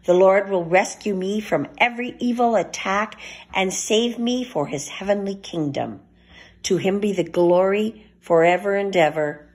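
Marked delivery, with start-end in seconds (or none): none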